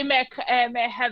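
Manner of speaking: demonstrating a French accent. Shown as a demonstration. Friend correcting how to order, French accent. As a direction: model it in a put-on French accent. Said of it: "No, no, no, you have to say".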